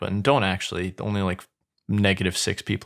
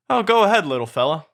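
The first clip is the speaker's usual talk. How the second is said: high-pitched coaxing, louder at the start; coaxing old woman; 7 semitones higher, 4 dB louder, faster than usual. Acted. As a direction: coax a pet, starting high. Old woman coaxes the donkey.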